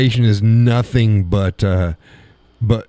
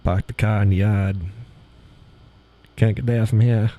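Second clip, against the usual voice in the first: mock New England accent with dropped Rs. Joking about Boston and New Hampshire talk, New England accent. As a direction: say it like an old New Hampshire local. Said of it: "the New Hampshire accent".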